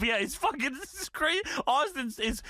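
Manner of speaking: ordinary talk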